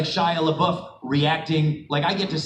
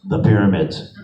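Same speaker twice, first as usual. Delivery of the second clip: imitating director; 9 semitones lower, 9 dB louder than usual. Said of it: "And he goes".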